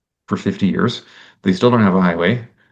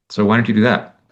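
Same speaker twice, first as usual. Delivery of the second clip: ordinary talk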